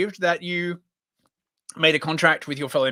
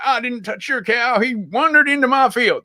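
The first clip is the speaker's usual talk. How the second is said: higher-pitched mock American farmer; bad US accent; 6 semitones higher than usual. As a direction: deny it in a put-on American country accent. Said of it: "crap U S accent".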